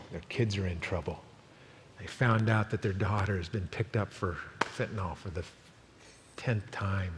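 quiet, low, earnest preaching; hushed heartfelt sermon close; soft pastoral appeal. Acted. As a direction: lean in and plead gently with the congregation.

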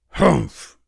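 loud, unimpressed humph; short loud grunt of scorn; bear grunt. A narrator's reading. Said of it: "the bear grunted".

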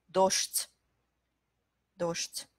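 Docht with voiceless sh and t together; devoiced cluster. Shown as a demonstration. Teacher demonstrating two consonants together, devoiced cluster. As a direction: say "Docht" as dosht, ending on a crisp t. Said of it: "on change en fait la prononciation des deux consonnes".

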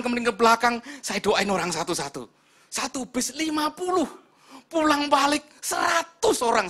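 high, loud testimony with rising fervour; full-force preaching. Real, not acted. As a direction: preach it with booming enthusiasm.

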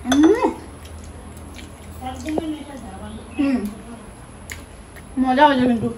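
talking while eating; muffled chewing speech; mouth full, chewing. Real, not acted. Seen 0: Woman chews with a full mouth while eating from a vegetable plate.

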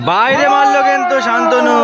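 high pitched exclamation; excited miss call. Real, not acted. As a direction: react to the miss. Penalty missed, commentator reacts.

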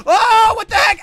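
loud, high-pitched shriek of shock; high, loud game-panic outburst; startled yell. Pretend. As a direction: yell out in playful fright.

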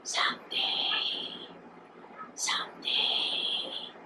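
very slow, voiceless whisper; whispered refrain like the muttering sea; sea's endless muttering. A narrator's reading. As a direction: stretch each word into a long whispered breath. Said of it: "endlessly muttering".

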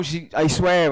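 ordinary talk, nothing marked

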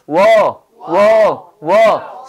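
워 repeated, each short and falling; drilled wo sound. Shown as a demonstration. Teacher drills the wool vowel for the class. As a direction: sound out 워 three times with rounded lips. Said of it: "울 발음합니다".

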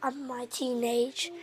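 soft late-night whisper; quiet at night. Real, not acted. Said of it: "I've gotta be quiet".

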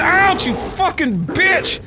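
high, stretched-out cursing; yelp, then curse the attacker slowly; pained angry yell. Real, not acted. Player hit from behind by a bear, pained angry yell.

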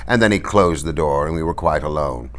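ordinary delivery

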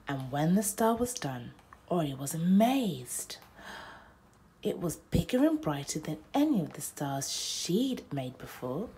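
plain reading voice

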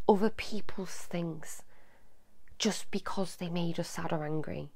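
hushed, breathless whisper of the rule. Acted. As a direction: drop to a whisper to make the rule sink in. Stressing a rule to children.

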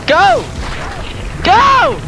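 panicked shout. Real, not acted. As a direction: scream at the driver to go. Yelling at teammate in game.

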